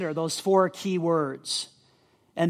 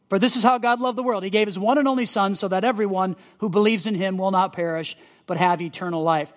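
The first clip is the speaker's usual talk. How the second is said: verse read fast in one run; quick read-through; faster than usual. Shown as a demonstration. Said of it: "So John three sixteen".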